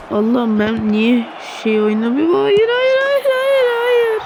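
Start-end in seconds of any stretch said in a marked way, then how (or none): 2.3-4.2 much higher, squealed hayır over and over; despairing no. Real, not acted. Gamer watching the third goal go in, high despairing wail.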